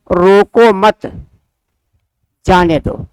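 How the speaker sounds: slow "रोको मत", clear break, then "जाने दो" falling; pause after मत. Shown as a demonstration. Teacher showing where the halt goes, pause after मत. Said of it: "यहाँ ठहराव दिया गया है".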